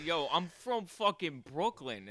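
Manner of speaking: New Yorker bragging where he's from; mock Brooklyn brag. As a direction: puff up like a Brooklyn local. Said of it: "and just being like".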